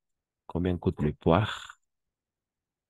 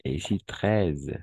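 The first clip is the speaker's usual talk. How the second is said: ordinary talk, nothing marked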